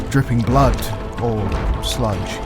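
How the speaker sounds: ordinary delivery, nothing marked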